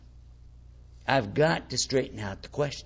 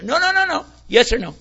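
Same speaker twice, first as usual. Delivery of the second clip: much higher, louder no no no no cutting him off; insistent questioner; 8 semitones higher, 5 dB louder than usual. Retold or imitated. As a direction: badger him, demand a yes or no. Acted pushy questioner.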